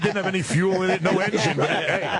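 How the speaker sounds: high, laughing through the words; chuckling as he admits he was sold. Real, not acted. To the hosts about the idle helicopter, laughing.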